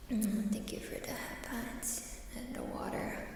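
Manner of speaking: normal talking voice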